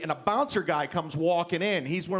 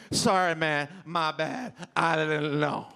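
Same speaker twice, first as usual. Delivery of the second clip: put-on drunk; 3 semitones lower, slower than usual. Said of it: "And I'm drunk".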